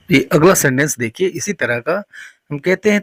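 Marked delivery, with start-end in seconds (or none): none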